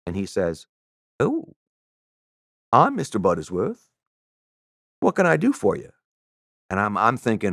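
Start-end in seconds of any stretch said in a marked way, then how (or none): 1.2-5.9 put-on Southern accent, high swooping "oh". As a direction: play a soft Southern gentleman, imitated. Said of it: "I don't have a very good Southern accent".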